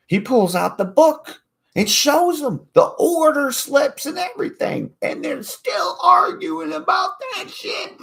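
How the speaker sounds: high, loud and building to a shout; rising excitement. Real, not acted. Telling about the argument, high building shout.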